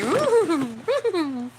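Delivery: high loud squealy woo-hoo; excited guinea pig. Retold or imitated. Guinea pigs popcorning with glee, high loud squeal. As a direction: squeal it like a happy piggy. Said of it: "They're like".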